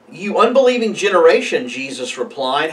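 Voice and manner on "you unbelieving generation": louder, higher, quicker rebuke; a little frustrated. A narrator's reading. Jesus rebuking his unbelieving disciples, a little frustrated. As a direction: scold them with mild frustration. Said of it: "Jesus is getting a little frustrated".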